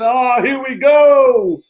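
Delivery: slow, loud, high-pitched burst of excitement; hyped-up loud slow call; excited shout. Retold or imitated. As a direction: stretch it out and shout it like a big launch. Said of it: "woke up in two thousand sixteen and said".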